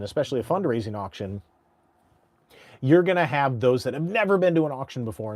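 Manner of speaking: ordinary delivery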